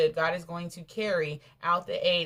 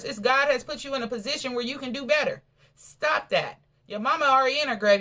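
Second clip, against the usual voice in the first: higher, louder preaching rebuke; fiery rebuke; 6 semitones higher, 6 dB louder than usual. Acted. Preacher rebuking the sibling over the house, raised and loud. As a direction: scold them hard toward God.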